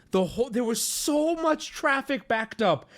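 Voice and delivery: ordinary delivery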